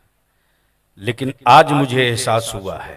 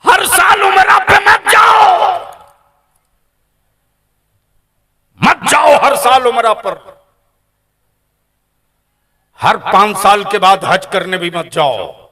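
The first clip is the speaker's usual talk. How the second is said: high, loud preaching shout; fiery preaching; 10 semitones higher, 9 dB louder than usual. Acted. Preacher warning the gathering about their children, fiery shout. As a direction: cry it out to the crowd.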